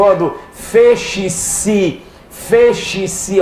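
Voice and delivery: feche-se stretched very slowly, hissing the s; feche-se said slowly with a long hiss; drawn-out demo. Shown as a demonstration. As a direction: hold the s sound in feche-se so it is heard. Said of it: "Esse si é aquele constritivo".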